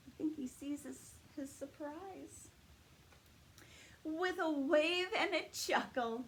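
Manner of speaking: lively read-aloud storytime voice; story narration for children. Acted. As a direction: read it like story time at the library. Reads picture book aloud.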